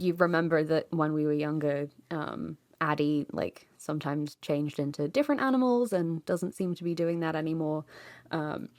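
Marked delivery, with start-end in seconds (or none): none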